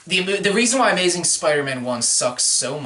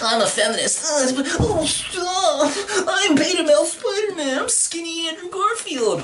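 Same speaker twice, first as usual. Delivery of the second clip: much higher, whiny mock beta male with a laugh; mocking weak boyfriend; 13 semitones higher than usual. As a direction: squeak it out like a pushover boyfriend. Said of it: "push around their boyfriend and be like".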